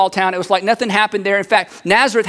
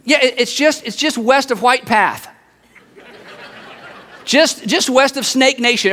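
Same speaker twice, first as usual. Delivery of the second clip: eager local; 4 semitones higher than usual. Acted. Local gives directions to Cherry Log, eager. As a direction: give obscure directions as if they were obvious. Acted directions to obscure town.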